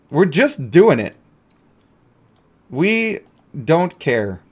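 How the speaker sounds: ordinary delivery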